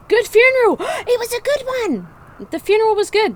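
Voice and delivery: high, loud delighted cheering; excited cheer. Real, not acted. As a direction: celebrate the good funeral with a gasp of joy. Celebrating the funeral result.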